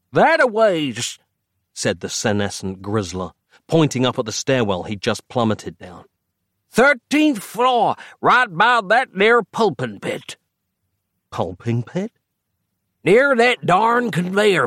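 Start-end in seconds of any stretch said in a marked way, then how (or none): 0.1-1.2 put-on high, folksy old codger drawl; old man voice. A narrator's reading. 6.7-10.4 put-on high, slow folksy old codger; old man voice. A narrator's reading.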